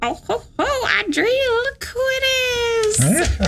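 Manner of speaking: put-on high old witch voice; old hag voice. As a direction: croak it like an old crone. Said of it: "I sound like an old woman".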